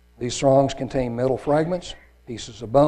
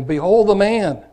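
Pilate proclaiming; 6 semitones higher, 6 dB louder than usual. Acted. Pilate presents Jesus.

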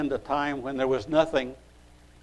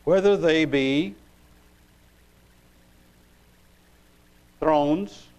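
scripture reading. Acted. Preacher reading Colossians one sixteen, scripture reading. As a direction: read it out deliberately, pausing after each phrase.

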